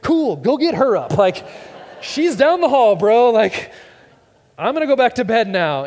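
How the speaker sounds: high, loud sarcastic brush-off; tired dad snapping high and loud; exasperated dad. Retold or imitated. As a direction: push the kid off to mom.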